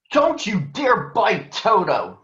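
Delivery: put-on girl defending her dog with a shout; shouted warning. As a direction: yell at the lion to stop. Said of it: "shouted Dorothy".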